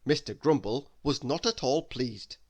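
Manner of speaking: ordinary delivery, nothing marked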